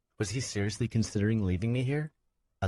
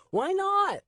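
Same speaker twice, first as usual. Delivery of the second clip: put-on high loud boy's wail; wailing child; 5 dB louder than usual. Nico wailing at Swen, high and loud. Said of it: "I wailed louder".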